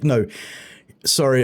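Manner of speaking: ordinary talk, nothing marked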